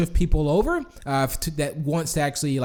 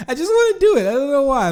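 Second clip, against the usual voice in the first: laughing; 10 semitones higher, 5 dB louder, faster than usual. Real, not acted. Giggling over his pirate attempts.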